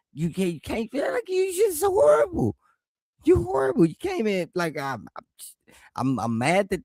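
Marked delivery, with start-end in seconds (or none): 0.1-3.9 high shouted "horrible"; playful high-pitched shout; mock shout. Real, not acted. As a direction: yell it way up high. Shouting peak of the roast.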